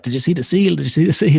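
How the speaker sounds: mimicking other swimmers buzzing about the seal; excited swimmers. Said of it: "they were like".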